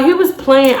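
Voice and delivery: ordinary delivery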